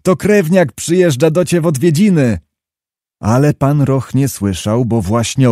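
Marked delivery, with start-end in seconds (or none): 0.0-2.4 high call across the field, hands cupped. A narrator's reading. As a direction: call to a rider far off, pitch raised. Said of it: "złożył ręce koło ust i począł wołać".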